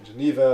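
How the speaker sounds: "Geneva" said the English way, juh-NEE-vuh; stressed NEE in English "Geneva"; English pronunciation. Shown as a demonstration. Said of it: "If I'm speaking English".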